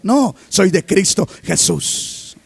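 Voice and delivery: ordinary delivery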